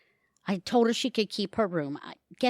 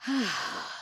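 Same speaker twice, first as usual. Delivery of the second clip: relieved sigh; 4 semitones higher, 6 dB quieter than usual. Acted. Alone at last after chaos.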